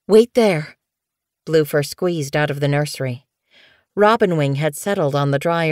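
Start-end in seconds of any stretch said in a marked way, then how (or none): 0.1-0.8 urgent order. Acted. Bluefur rushing off to help.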